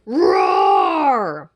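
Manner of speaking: low, loud crying roar; monster roar. Acted. Sea monster answers the princess, a loud low roar. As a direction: bellow back at her. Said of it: "said the sea monster".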